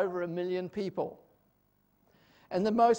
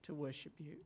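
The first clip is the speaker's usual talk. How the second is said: hushed, reverent prayer voice, much softer; 4 semitones lower, 9 dB quieter than usual. Real, not acted. Pastor opening the Pentecost service with prayer, hushed and reverent. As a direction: pray quietly and humbly to the Lord.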